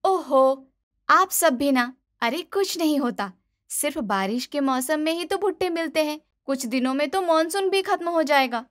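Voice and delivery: airy, carefree bahu; dismissive daughter-in-law. Acted. Daughter-in-law waving off family warnings: high, dismissive.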